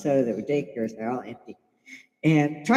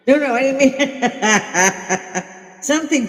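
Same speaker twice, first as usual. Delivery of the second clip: breaking into real laughter mid-sentence; laughing helplessly; 7 semitones higher, 5 dB louder, slower than usual. Real, not acted. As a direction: laugh through the words, slow and higher. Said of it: "laugh hysterically".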